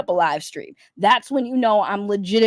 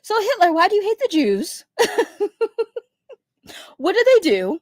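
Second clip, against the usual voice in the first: high, put-on interviewer voice pitched up; hammed-up interviewer voice; mock interviewer; 8 semitones higher than usual. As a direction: play a mock talk-show host questioning.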